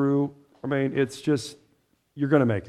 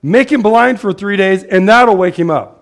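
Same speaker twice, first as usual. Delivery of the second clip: Jesus declaring; 4 semitones higher, 7 dB louder than usual. Retold or imitated. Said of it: "Jesus said".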